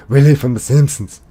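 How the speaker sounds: put-on loud, high, mad Scottish accent; Willie. Streamer echoing a viewer's Simpsons guess, shouted Scottish impression. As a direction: bellow it as an irate Scotsman. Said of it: "I did it better earlier".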